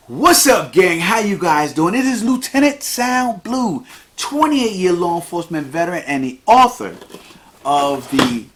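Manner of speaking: high, energetic host introduction; hyped-up host announcing himself; podcast show intro. Acted. Performs the formal show intro.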